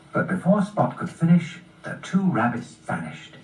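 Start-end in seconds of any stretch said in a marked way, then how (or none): none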